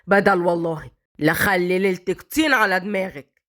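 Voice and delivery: low, mocking scolding; harsh mother-in-law. A narrator's reading. Mother-in-law mocking Malak and threatening her, harsh and low.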